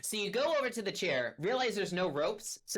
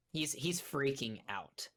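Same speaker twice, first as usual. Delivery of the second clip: normal talking voice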